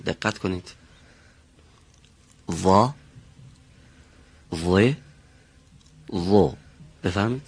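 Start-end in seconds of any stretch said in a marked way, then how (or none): 2.5-6.5 وا، و، و as heavy emphatic Arabic ẓa syllables; heavy, back-of-mouth sound. Shown as a demonstration. Teacher modelling for Mr. Shekari, heavy back sound. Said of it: "صدا یه مقداری برمیگرده عقب دهان".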